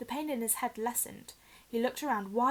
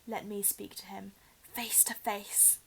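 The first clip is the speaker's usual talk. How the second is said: slow, unvoiced whispered plea from a hidden face; a creeping, slow whisper; eerie whisper; 6 dB quieter, slower than usual. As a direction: breathe it slowly, barely voiced. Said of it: "The high voice spoke again".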